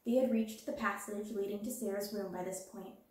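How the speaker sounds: ordinary delivery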